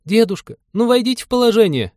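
put-on loud, very high girlish plea; high-pitched imploring cry; pleading young woman. A narrator's reading. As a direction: beg an old man to understand. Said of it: "взмолилась Полина".

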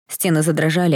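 plain reading voice

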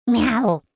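imitated mew talking back; acted cat cry; cat meow. A narrator's reading. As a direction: meow in reply to a scolding. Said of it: "возмущённо мяукнул тот самый Люцифер".

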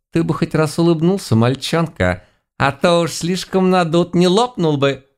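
put-on high-pitched, needling old woman; mocking old granny. A narrator's reading. Granny poking a puffed-up lad, mocking.

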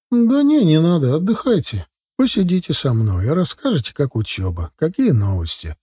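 high-pitched grandmother, gently waving off help; hospitable grandmother. Acted. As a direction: wave the offer away in a high voice. (радушная бабушка, высоким голосом)

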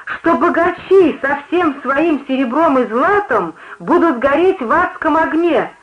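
high, drawn-out preacher's threat; high note. A narrator's reading. Preacher threatening the rich with hellfire, on a high note. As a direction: carry it slowly up to a high pitch. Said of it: "закончила она на высокой ноте".

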